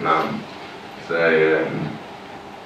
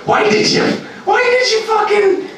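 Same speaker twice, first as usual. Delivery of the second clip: angry yell. Retold or imitated. Angry woman yelling at him for sneaking up on her. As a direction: shriek at him in anger. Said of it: "the angry fucking".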